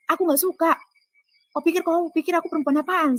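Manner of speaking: high-pitched, affronted rebuke; retold indignant protest. Woman rebukes the man for his advances, indignant.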